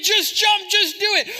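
high, loud urging; imitated goading friends. Friends push someone to leap, loud and insistent. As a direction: egg them on like a reckless pal. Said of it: "our friends are like".

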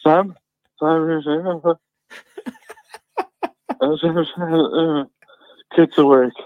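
lightly put-on voice calling for attention; retold phone caller. Co-host imitating the early-morning phone call, lightly voiced caller. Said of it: "from a voice that went".

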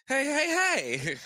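high-pitched amateur impression; bad Deadpool voice. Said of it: "I don't do a good Deadpool voice".

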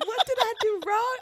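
high-pitched retold protest; scared. Driver retelling being pulled over, high scared protest. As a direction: plead innocence in a high, alarmed voice. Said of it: "I was so scared".

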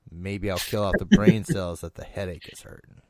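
usual speaking voice

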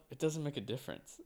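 soft, offhand aside; understated comment under the breath; said to oneself. Retold or imitated. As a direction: say it quietly, as a private remark. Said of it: "I said to myself".

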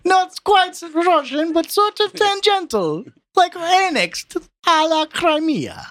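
high, hammed-up Eastern European accent; Lithuanian accent. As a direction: play it like wild and crazy Lithuanians. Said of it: "this accent is more fun for me".

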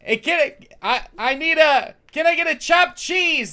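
loud, high New York guy; Doctor Joey voice. Acted. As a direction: belt it out like a brash New Yorker. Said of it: "is that Doctor Joey?".

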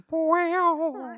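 loud, very high meow; Catwoman impression. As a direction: yowl it like a campy Catwoman. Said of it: "doing my best Catwoman impression".

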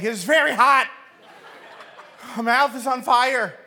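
loud, high cry of agony; hammed-up burning-mouth yell; pained outburst. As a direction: blurt it out as if your mouth burns. Kid finally admits the burning pain.

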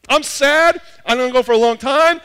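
very high, loud recap of requests; mock-pleading shout, building to the last ask; bold demands. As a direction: pile up brazen asks at full pitch. Bold requests piled up, acted.

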